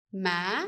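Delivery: ma with the pitch climbing up at the end; rising tone. Shown as a demonstration. Vietnamese teacher demonstrating the up tone, rising. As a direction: lift ma upward like the dấu sắc tone. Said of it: "it goes up".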